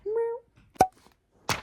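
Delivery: high, loud kitty meow; drawn-out, high-pitched mew; cat meow. Retold or imitated. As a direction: mimic a cat piping up. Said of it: "He said".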